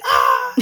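a sudden alarmed "Ah!"; panicked yell. Retold or imitated. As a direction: yelp as if the door slams. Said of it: "it's like".

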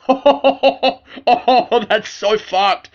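high, loud mock reaction of shock, slowing on the end; own shocked reaction. Retelling his reaction to his friend's sad news, mock-shocked blurt. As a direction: replay your own blurted reaction, a bit hammed-up. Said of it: "my first reaction was just like".

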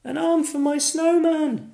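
put-on high, loud happy boy voice; excited boy. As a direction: exclaim like a delighted child. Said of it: "he says with a laugh".

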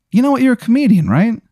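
high, eager inmate voice; mock eager inmate. An inmate realises the new prisoner is a comedian, high and eager. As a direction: recognise a celebrity in a high voice.

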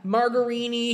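high-pitched mock dad outrage; ranting father. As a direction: imitate a dad fed up with foreign food. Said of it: "He's like".

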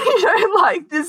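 ordinary delivery

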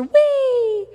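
high mock roller-coaster squeal; playful whee. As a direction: let out a drawn-out, playful whee. Imitates a roller coaster ride.

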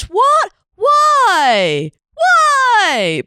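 high, loud whiny "why"; shrill tantrum voice. Retold or imitated. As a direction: squeal it like a tantrum. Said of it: "she goes".

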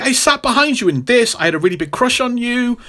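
high, gushing imitation; Jessica. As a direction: confess it in a high mock voice. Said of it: "She's like".

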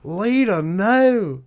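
high pleading shout; calling out. Acted. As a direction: yell to stop her. Calling out in skit.

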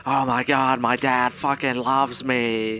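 put-on student voice, slow at the end; comic impersonation of an overwhelmed son; Nate. Shown as a demonstration. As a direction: demonstrate the student's voice, stretching the last words. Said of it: "It's kinda like that".

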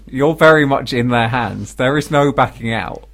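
ordinary delivery

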